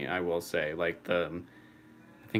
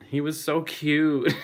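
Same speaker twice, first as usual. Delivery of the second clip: higher, louder, drawn-out cooing; adoring gush; 7 semitones higher, 8 dB louder, slower than usual. Real, not acted. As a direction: gush over an adorable pet, stretching it. Gushing over beloved dragon dog.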